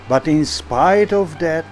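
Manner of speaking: ordinary delivery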